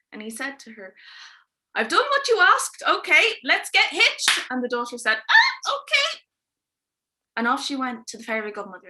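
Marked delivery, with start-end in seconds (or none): 1.7-4.5 high, loud and eager king's voice; a pompous king booming out his demand. Acted. King to his daughter, loud and eager. 5.2-6.2 very high, loud squeak of reluctant agreement; a princess squeaking a forced yes; squeaky princess. Acted. Princess answering the king, squeaky and high.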